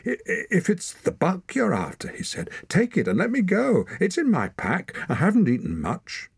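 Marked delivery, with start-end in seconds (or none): 0.1-2.1 high, stammering nervous voice; dry-mouthed fear. A narrator's reading. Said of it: "so dry that he could hardly speak". 2.7-6.3 high-pitched anxious pleading; dry-mouthed fear. A narrator's reading. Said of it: "so dry that he could hardly speak".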